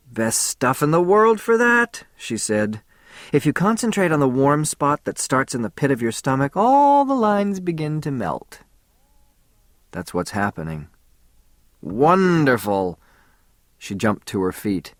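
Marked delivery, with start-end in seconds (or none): 0.1-2.1 a light woman's voice, higher and slow; lightly played woman savouring it; Faye's voice. A narrator's reading. Faye voiced in story. 3.3-8.6 lightly played woman savouring it; Faye's voice. A narrator's reading. Faye voiced in story. 11.8-13.0 slow drawn-out delight. A narrator's reading. Faye delighted.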